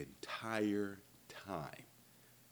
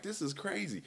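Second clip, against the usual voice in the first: This is crazy, pitched high in shocked disbelief; 11 semitones higher than usual. Retold or imitated. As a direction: squeak out This is crazy as if stunned. Overhearing intimate phone call.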